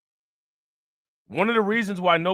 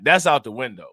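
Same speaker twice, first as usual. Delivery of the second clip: usual speaking voice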